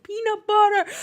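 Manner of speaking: put-on loud, high Jamaican-accented shout of "Peanut butter!"; Jamaican baby shout. Wife imitates the Jamaican baby from the video, shouting "Peanut butter!" in a Jamaican accent. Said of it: "shouts in the thickest Jamaican accent".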